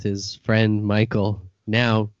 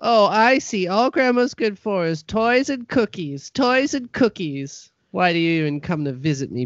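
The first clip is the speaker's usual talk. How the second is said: put-on high, offended old granny; bitter granny complaining; grandma voice; 7 semitones higher than usual.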